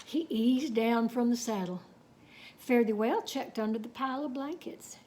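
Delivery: plain reading voice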